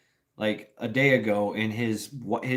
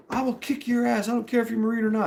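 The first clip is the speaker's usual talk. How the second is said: put-on much higher squeaky drunk retort; mock drunk yell; 10 semitones higher, faster than usual. Drunk guy threatening.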